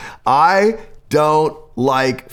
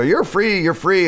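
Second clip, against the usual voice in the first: mock proclaiming; 6 semitones higher than usual. Pretending to tell your kids they're free, hammed-up and higher. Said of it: "looking at your kids and go".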